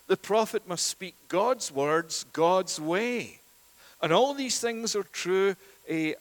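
normal talking voice

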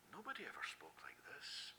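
awed breathy whisper. Acted. Preacher voicing our awe at his words, whispered. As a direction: whisper it like a secret wonder.

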